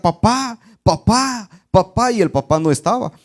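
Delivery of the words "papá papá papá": put-on high calling of a scared child. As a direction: call for dad from the window, pitched up. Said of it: "empezó a gritar".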